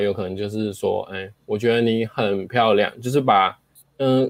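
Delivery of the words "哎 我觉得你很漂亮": usual speaking voice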